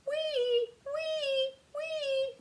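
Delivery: very high siren squeal; loud siren noise. A narrator's reading. Mouse luring the dog, very high loud siren. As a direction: wail it high like an ambulance. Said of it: "good and loud".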